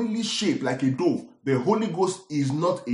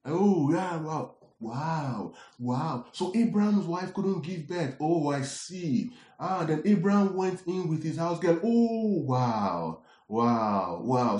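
softer, drawn-out wows of a marvelling reader; engrossed storybook reader; 4 dB quieter, slower than usual. Acted. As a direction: mimic someone lost in a story, marvelling. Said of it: "reading Genesis like a storybook".

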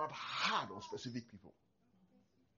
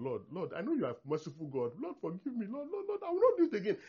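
rising higher into a hammed-up plea; mock pleading prayer; 6 semitones higher than usual. Preacher imitating believers begging God, rising mock plea. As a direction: climb up into a wailing mock prayer.

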